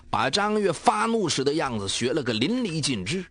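ordinary delivery

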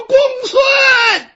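loud high yell of a name; shouting at the top of his voice. Acted. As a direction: call him from far behind down the street. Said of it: "包兴扯着嗓子就喊".

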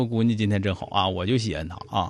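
low, soft, doting parent's praise; quiet, low-voiced compliment; praising the daughter. Shown as a demonstration. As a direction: murmur fond praise, pitched well down. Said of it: "你就夸他".

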